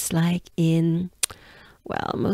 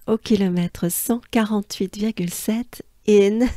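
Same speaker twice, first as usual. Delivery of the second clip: usual speaking voice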